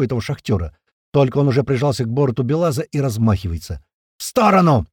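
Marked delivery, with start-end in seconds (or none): 4.2-4.9 raised-pitch shouted warning; yelled warning. A narrator's reading. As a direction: yell to clear the way. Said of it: "заорал я". (крик-предупреждение)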